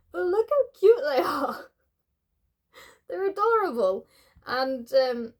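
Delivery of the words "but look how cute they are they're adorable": high, loud gushing; gushing excitement. Real, not acted. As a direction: gush over how cute they are. Showing crochet chicks.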